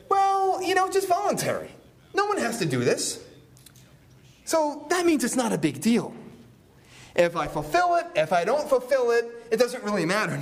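high, flippant mock voice of a careless vower. Preacher mocks a careless vower to the congregation, high and flippant. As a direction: toss it off like a vow means nothing.

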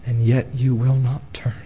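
hushed whisper of sorrow. Acted. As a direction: breathe it as a grave warning. Grave aside to congregation.

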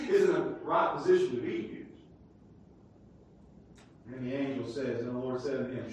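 usual speaking voice

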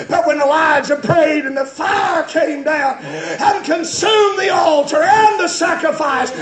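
high, shouted sermon climbing to a peak; full-force preaching. Real, not acted. Preacher proclaiming the Lord he is the God, full-force shout. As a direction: cry it out to the whole church.

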